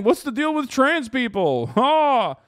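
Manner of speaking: high, loud comic voice with a fake laugh; mock edgy comic. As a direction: sneer it like a hack comic. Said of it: "You're just saying".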